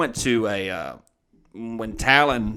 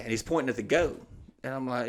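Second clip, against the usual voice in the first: normal talking voice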